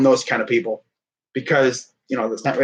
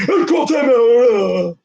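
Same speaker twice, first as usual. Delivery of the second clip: slow, drawn-out, higher coach call; mimicking the coach; 9 semitones higher, 4 dB louder, much slower than usual. Fan mimicking the coach calling timeout, slow drawn-out call. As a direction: drag the call out like a coach yelling from the sideline.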